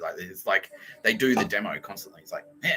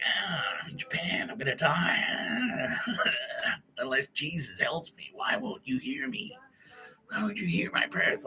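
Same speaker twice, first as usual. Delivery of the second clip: high, slow, hammed-up whimpering prayer; mock crying prayer; 6 semitones higher, 5 dB quieter than usual. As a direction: whimper a desperate prayer in jest.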